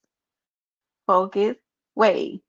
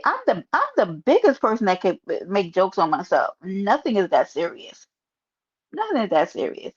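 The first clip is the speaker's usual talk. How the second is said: normal talking voice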